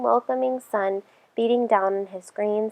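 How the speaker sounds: plain reading voice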